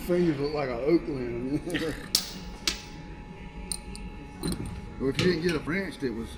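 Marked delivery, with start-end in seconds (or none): 1.7-4.9 breathy laugh. Real, not acted. After joke.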